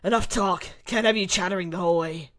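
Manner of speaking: loud, fast order; stern villain command. Acted. Krauser silencing his chattering captive, loud and impatient. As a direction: shut the captive up.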